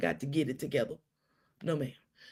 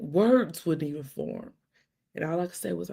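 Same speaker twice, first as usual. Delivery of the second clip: usual speaking voice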